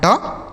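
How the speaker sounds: "ट" as a lone t sound, gh silent; GHT read as T. Shown as a demonstration. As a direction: give just a short "ट". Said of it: "केवल आपको ट पढ़ना है". ("ट", केवल ट की ध्वनि)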